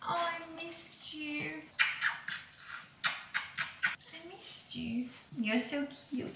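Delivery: very soft, slow, cooing; a hushed, slow coo to a pet. Real, not acted. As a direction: melt over the little one.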